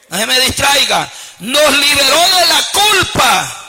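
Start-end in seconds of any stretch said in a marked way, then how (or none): 1.4-3.6 slow, higher-pitched proclamation; impassioned preaching. Real, not acted. Preacher telling congregation they are freed from guilt, impassioned. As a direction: stretch it out like a rallying cry. Repeats climax to distracted congregation.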